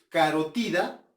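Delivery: carotida said ca-ro-TI-da; Spanish pronunciation. Shown as a demonstration. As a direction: put the stress on TI in carotida. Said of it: "como dicen los españoles". (carotida a la española, con fuerza en TI)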